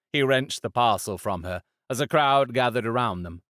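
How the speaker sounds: ordinary delivery, nothing marked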